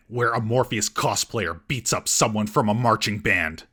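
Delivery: normal talking voice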